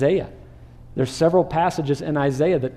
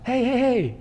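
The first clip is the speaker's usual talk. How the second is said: higher mock hush; disciples hushing; 8 semitones higher than usual. Disciples hushing the blind man, mock scolding. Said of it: "and the disciples perhaps".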